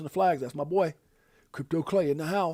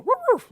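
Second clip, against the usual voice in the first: playful dog bark; 5 dB louder than usual. Pretend. Shout out to the dog.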